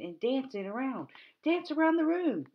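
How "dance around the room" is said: excited call. Real, not acted. Teacher cheering kids to dance.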